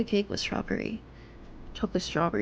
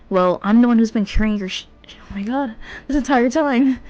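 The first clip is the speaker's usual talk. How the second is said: exasperated, raised voice; loud complaining; 5 dB louder than usual. Acted. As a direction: complain loudly, fed up. Character complaining loudly in dialogue.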